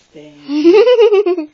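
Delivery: high, loud laughing thanks. Real, not acted.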